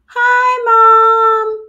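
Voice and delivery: high, loud greeting called out; cheery shout. Acted. Fly, stuck in the web, calls out loudly to her mom. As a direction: yell hello across the room.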